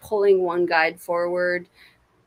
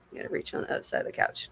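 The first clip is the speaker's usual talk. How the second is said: quick whisper under her breath; half-whispered aside while stretching for something; 10 dB quieter, much faster than usual. Real, not acted. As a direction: mutter it to yourself while reaching. Reaching aside mid-stream, muttering.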